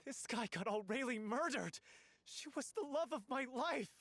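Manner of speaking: anguished accusation. Acted. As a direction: voice climbing with grief and rage. Grieving lover accuses.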